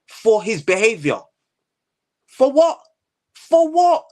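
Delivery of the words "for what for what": indignant "For what?" barked louder and higher; ranting vlogger challenging a rapper, loud and heated. Real, not acted. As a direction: demand it, fed up and louder. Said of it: "bruv, I get heated".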